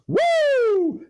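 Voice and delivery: huge high-pitched whoop, imitating the arena announcer; mock the announcer's goal call with a big, high "Woo!". Mocking the Garden announcer's goal celebration, a high whoop. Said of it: "and then he goes".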